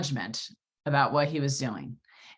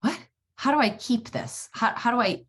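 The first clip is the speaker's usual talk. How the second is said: confused; 8 semitones higher than usual. Retold or imitated. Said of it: "I was like".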